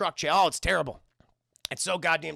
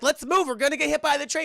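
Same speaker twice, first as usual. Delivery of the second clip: high, raised mock urgency; urgent warning; 8 semitones higher, 5 dB louder than usual. Imitating Liv urging John off the tracks, urgent raised voice. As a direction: press him to hurry, playing alarmed. Acting out warning of coming train.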